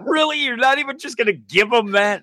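high disbelieving question; mock incredulous squeak. Host reacting to Hawkeye trashing the candy, high incredulous squeak. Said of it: "And I'm like".

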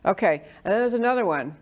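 normal talking voice

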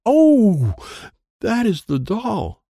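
high, loud startled gasp, then slowing. A narrator's reading. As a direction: caught off guard by the doll. Startled by screeching doll.

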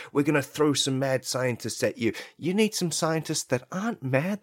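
ordinary talk, nothing marked

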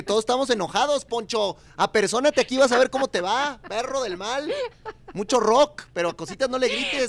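loud, high, playful fury; mock angry rant. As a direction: tell off the bully in fun, loudly. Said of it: "angry rant at Poncho". (regaño fingido, fuerte y agudo)

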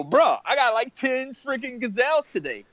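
high-pitched, mock boastful lion; bragging lion impression. Lion bragging about piling up gazelles, jokey high-pitched impression. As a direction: play a lion crowing over the day's kill. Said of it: "That's a great lion impression".

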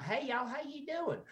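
high, chirpy Southern greeting; sweet small-town Southern hello; friendly Southern accent. Retold or imitated. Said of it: "don't speak like that on stage".

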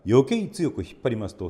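ordinary delivery

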